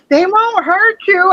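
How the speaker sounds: high, drawn-out mock reassurance; high-pitched retold soothing; imitating mother-in-law.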